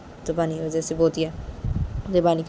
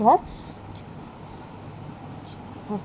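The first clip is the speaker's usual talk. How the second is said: usual speaking voice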